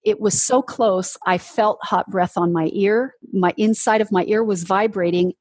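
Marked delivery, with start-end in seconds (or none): none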